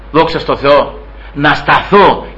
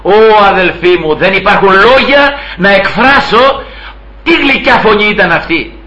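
impassioned preaching; 5 semitones higher than usual. Acted. Preacher extols the sweet voice.

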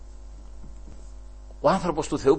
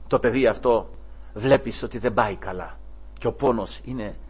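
usual speaking voice

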